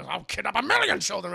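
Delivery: villain tycoon line. Retold or imitated. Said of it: "Corporate tycoon".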